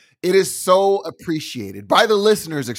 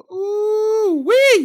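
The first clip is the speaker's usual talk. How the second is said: excited squeal; 4 dB louder than usual. Real, not acted. Reacting to "trip stab.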